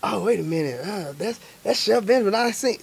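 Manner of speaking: hushed passerby spotting someone; quiet imitation of a stranger; imagined onlooker. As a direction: say it softly, like pointing someone out.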